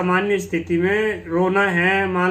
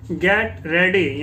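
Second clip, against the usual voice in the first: very slow, every sound separate; slow model reading; much slower than usual. Shown as a demonstration. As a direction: stretch get ready out for learners. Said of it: "teacher models the English phrase".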